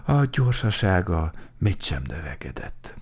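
plain reading voice

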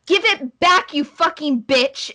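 high, loud opening burst, easing slightly; angry shout. Acted. Girl in green at the other girl, shouting. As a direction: shout it like a furious sibling. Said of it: "the girl in green is shouting".

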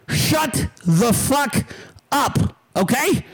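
slow, word-by-word angry order; emphatic command. Real, not acted. Streamer orders commenter's inner voice silenced.

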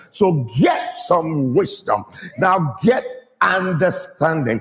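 slow, weighty GET, then WISDOM and UNDERSTANDING stressed; drawn-out, insistent GET; emphatic inflection. Shown as a demonstration. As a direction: punch GET so the child knows it matters. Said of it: "put some accent on it".

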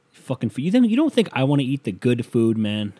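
ordinary talk

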